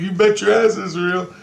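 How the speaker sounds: high-pitched quoted comeback; retold retort. Retelling the man's answer about reality, high insistent retort. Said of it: "And he's like".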